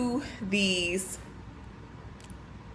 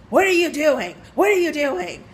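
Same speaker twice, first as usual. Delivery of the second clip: imitating an alarmed, higher-pitched outburst; mock indignant customer, pitched up; angry customer; 6 semitones higher than usual. Older customer jumps up at the cashier, angrily imitated. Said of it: "she's very angry".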